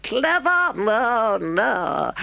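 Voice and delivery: high, mock-modest Toad voice; a toad swelling with pride; boastful Toad. A narrator's reading. Toad fakes modesty to Mole, boastful.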